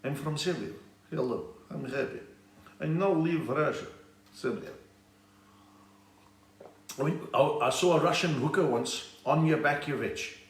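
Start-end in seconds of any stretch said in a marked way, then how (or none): 0.0-4.7 light mock Serbian accent, broken English. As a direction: lightly play a Serb with a put-on Serbian accent. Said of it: "is that where they talk like that?".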